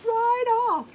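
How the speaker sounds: triumphant high burst; excited exclaim. Real, not acted. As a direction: announce the clean cut with glee. Napkin edge cut off cleanly.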